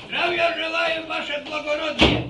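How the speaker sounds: high, loud mock-formal toast; hammed-up shout. Old man sends the girls off with a shouted toast. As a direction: bellow a jokey farewell.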